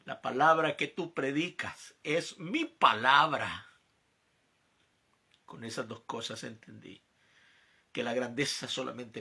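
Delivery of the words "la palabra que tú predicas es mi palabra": slow, solemn divine voice, lightly voiced; a hint of a solemn God, slow; voice of the Lord. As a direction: speak as God reminding him of his calling. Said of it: "Y me dijo".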